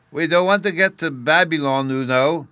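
put-on high, loud child's voice; young Bruno. A narrator's reading. Little Bruno explains to the officer while swinging, in a high child's voice. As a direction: pipe up loudly like a small boy. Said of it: "Bruno explained as he swung".

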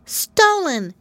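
high little pig voice; mouth full. Acted. Pigwig answers with her mouth full. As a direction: say it through a mouthful. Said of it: "replied Pigwig, with her mouth full".

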